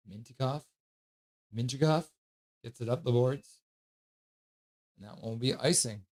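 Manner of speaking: casual live call of the game; hockey play-by-play. Acted. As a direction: narrate the play like a relaxed announcer.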